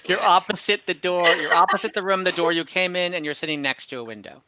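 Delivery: ordinary talk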